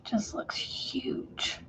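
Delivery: slow soft whisper. Real, not acted. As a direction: breathe the words slowly. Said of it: "repeated phrase whispered".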